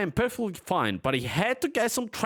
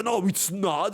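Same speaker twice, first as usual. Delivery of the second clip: ordinary delivery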